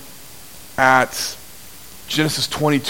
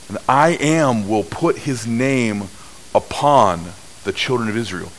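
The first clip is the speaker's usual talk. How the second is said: solemn scripture reading; 5 semitones lower than usual. Acted. Rereads the priestly blessing.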